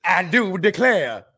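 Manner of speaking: slow, prim Southern belle line; mock Southern drawl. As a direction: play a prissy Southern lady. Mock Southern genteel aside.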